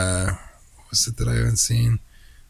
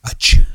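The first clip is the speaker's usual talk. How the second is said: loud sneeze; 6 dB louder than usual. Acted. Reads a fan's free-sneeze letter.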